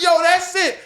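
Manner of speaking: high and loud thrilled exclaim; hyped discovery; excited. Retold or imitated. Excited about band name.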